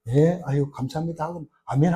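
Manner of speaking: soft, perfunctory thanks; half-hearted agreement. Acted. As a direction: imitate listeners politely agreeing without belief, softly. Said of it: "진짜로는 안 믿거든".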